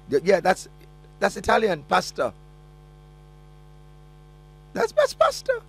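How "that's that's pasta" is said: very high squeaky joke voice; mock squeal. Co-host joking about pasta.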